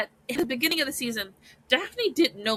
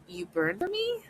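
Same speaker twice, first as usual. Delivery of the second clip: soft question leaping high at the end; quietly astonished, pitch climbing into the question; retold disbelief; 10 semitones higher, 5 dB quieter than usual. Daphne asks whether he burns for her, retold disbelief. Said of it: "Wait, what?".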